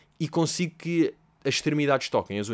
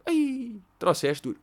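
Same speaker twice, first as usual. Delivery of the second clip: squeaky high "Ai", a delighted thank-you; grateful surprise; 10 semitones higher, much slower than usual. Retold or imitated. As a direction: act pleasantly surprised by the gift. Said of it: "a minha reação é, por um lado, agradeço". (surpresa agradecida, com um "Ai" bem agudo)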